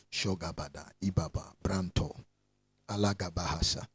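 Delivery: low, soft murmured syllables; quiet, low-pitched spiritual utterance; praying in tongues. Real, not acted. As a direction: pray under your breath in tongues. Preacher breaks into praying in tongues.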